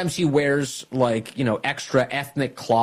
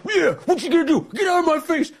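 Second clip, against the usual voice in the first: mock taunting activist; 14 semitones higher than usual. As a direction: sneer it up at the cops mockingly.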